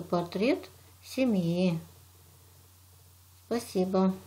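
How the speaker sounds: usual speaking voice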